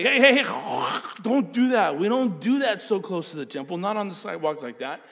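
loud, high objecting cry; mock protest. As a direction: shout it like a scandalised bystander. Onlookers protest the spitting.

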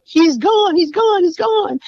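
high-pitched cry of bad news; retold anguished yell. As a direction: yell it out in panicked grief, retold. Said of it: "she just yells out".

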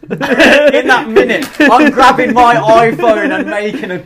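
high, loud and slow through laughter; giggling while boasting of billions; laughing fantasy. Pretend.

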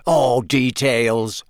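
slow, drawn-out dismissal; dismissive Bagman. A narrator's reading. Official brushing off his colleague's caution, dismissive. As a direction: wave the word away. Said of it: "waving the word away".

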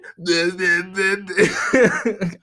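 dopey caveman blabbering that breaks into laughter; cartoonish Neanderthal nonsense syllables, then a laugh; mock caveman gibberish. Mimicking women impersonating dudes, caveman gibberish and laughing. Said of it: "Fred Flintstone caveman Neanderthal shit".

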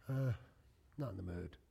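low, soft, flat brush-off; flat refusal. Retold or imitated. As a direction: turn them down quietly and low. Spouse turning down partner.

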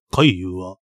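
ordinary delivery

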